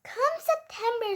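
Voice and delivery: ordinary delivery, nothing marked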